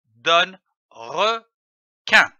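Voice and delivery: « requin » lu lentement, syllabe par syllabe; étirez « donne requin » très lentement; lecture lente. Shown as a demonstration. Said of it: "L'association de ces deux syllabes". (« requin » détaché en deux syllabes lentes)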